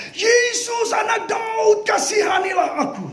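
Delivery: high desperate shouts for mercy; a blind beggar begging Jesus for mercy, shouting; desperate cry. Acted. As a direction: cry out to Jesus at the top of your voice. Blind Bartimaeus crying out.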